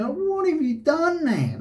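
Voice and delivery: sudden high, anguished anger at the man; angry outburst. Retold or imitated. To the man who punched him, pained angry cry. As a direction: let the rage break through, high and strained. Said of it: "this anger just come over me".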